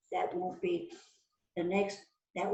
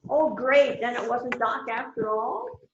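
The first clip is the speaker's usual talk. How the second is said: louder, higher eager relief; 4 semitones higher, 6 dB louder than usual. Acted. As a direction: jump in with relief. Suspect relieved the case is solved.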